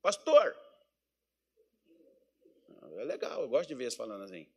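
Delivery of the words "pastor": Pastor with a strong curled r in a southern Minas accent, said higher. Shown as a demonstration. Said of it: "os caras ficou com sotaque".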